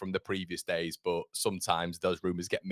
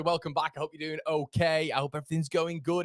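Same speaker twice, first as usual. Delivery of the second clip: usual speaking voice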